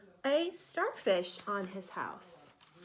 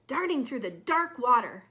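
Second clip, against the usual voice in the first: ordinary delivery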